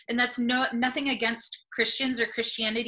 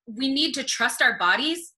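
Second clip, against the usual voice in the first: usual speaking voice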